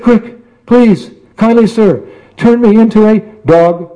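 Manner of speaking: high, urgent pleading; frantic cat begging. Acted. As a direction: beg the yogi in a panic. Chased cat begs the yogi.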